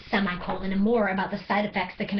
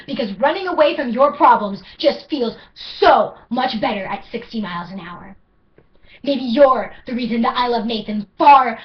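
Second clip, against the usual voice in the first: higher, louder, driving poem; building intensity; 4 semitones higher, 8 dB louder than usual. Acted. Poet confessing love for Nathan, rising loud. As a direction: let it swell and push forward.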